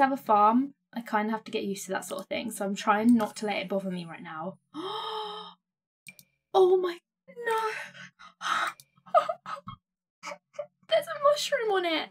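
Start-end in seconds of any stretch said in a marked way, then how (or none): 4.7-12.1 overwhelmed, catching breath; startled happy gasps; gasp of delight. Real, not acted. Gasping at new clothes.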